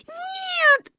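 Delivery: very high wailing refusal; crying child. A narrator's reading. Granddaughter refusing Ba's distraction, crying. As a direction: wail it like a tearful little girl. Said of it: "плакала Гайне".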